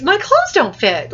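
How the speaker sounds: high, loud burst of excitement; excited, surprised. Shown as a demonstration. Teacher shows how the boy really says it, excited and surprised. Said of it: "he's excited. He's, he's surprised".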